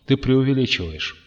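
ordinary delivery, nothing marked

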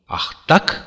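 a stung, defiant gasp of a retort; exclaiming. A narrator's reading. Zlata, challenged by Curtis, an indignant exclamation. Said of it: "воскликнула девушка".

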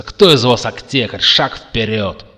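loud order, slowing at the end; shout. A narrator's reading. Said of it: "крикнул Макс". (громкий приказ)

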